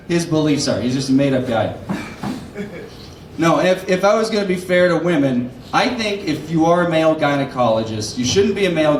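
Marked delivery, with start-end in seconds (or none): none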